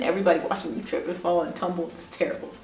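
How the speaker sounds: breathy whisper of dread; whispered aside. Acted. Telling viewers about tripping in front of the bus, whispered. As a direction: drop to a hushed whisper, as if it's too awful to say aloud. Mock horror at falling in public.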